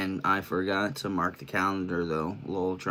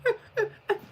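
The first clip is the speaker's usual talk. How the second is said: crying laugh. Real, not acted.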